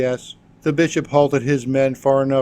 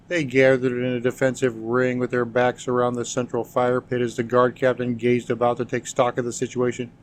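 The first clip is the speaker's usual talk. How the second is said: ordinary delivery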